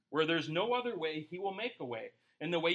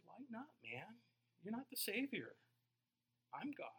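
gentle, much softer, light teasing; soft voice of God; 4 semitones higher, 11 dB quieter than usual. Retold or imitated. God gently teasing the tense pastor, soft. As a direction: say it softly with a wink. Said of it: "He says".

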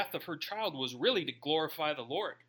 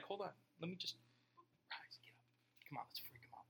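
hushed, conspiratorial whisper; mock whisper; 19 dB quieter than usual. Pretending to pray over a funeral casket, sneaky whisper. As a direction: lean in and whisper the plan.

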